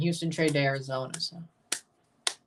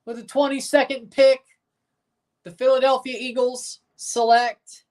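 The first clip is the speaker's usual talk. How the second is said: higher, louder draft-podium announcement; punchy, raised draft announcer voice; Goodell announcing; 5 semitones higher, 3 dB louder than usual. Acted. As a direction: announce the pick like the league commissioner. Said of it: "Here comes Goodell to give the Eagles pick".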